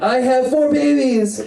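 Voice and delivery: high, slow, put-on voice announcing four babies. Mock proud dad announcing his four babies, high and slow. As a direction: drag out the news like a proud dad on stage. Comic voicing [name]'s monologue.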